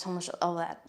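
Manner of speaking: usual speaking voice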